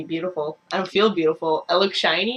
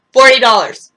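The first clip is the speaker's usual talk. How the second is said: outraged shout; 5 semitones higher, 15 dB louder than usual. Real, not acted. Angry about forty dollar brush.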